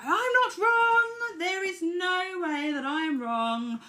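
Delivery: high, slow, loud mock-indignant insistence; indignant ego voice. Speaker mocking her own ego, high and loud. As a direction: play your stubborn ego digging in.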